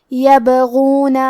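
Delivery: یبغون very slow, the ب bounced with qalqala; tajweed demo. Shown as a demonstration. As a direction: say یبغون slowly with an echoing ب. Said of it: "حرف البا کو ہلاتے ہوئے یہ کلمہ کو پڑھیں گے". (یبغون بہت آہستہ، با کو قلقلہ سے ہلاتے ہوئے)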